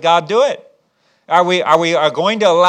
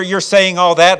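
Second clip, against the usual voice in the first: usual speaking voice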